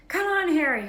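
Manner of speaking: high, loud cheering scream. Acted. Hermione cheers Harry's dive from her seat: screamed. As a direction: scream him on. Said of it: "Hermione screamed".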